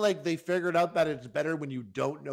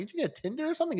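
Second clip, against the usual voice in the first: incredulous question; 11 semitones higher, 3 dB quieter, much faster than usual. Retold or imitated. Mocking friend's dating app story.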